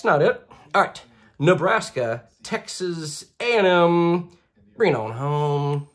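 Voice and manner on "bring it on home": usual speaking voice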